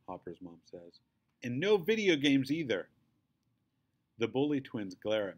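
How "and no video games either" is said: louder, firm command; stern order. A narrator's reading. Mother ordering her sons to their rooms, stern. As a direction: send them off, no arguing. Mom adds punishment.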